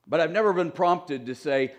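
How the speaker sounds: ordinary delivery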